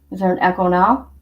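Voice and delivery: usual speaking voice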